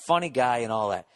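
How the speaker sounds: normal talking voice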